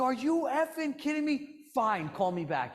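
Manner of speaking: high, loud and slow angry outburst; mock the client blowing up; furious client. Client yelling at agent who must hang up, furious. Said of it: "He's like".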